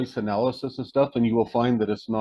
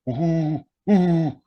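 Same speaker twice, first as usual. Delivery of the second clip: imitated hooting call, a bit higher and rising; owl-like hoot; 5 semitones higher, 3 dB louder than usual. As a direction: hoot like an owl calling into the woods. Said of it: "Watch, it'll mimic me".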